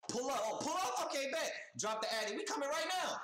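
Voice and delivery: playing both sides of a street showdown, amped up; mock street challenge. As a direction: act out eagerly taking the challenge. Acted street challenge exchange.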